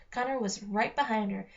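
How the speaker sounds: ordinary delivery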